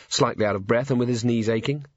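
ordinary delivery, nothing marked

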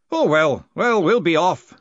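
put-on loud, high old man's voice; departing old master.

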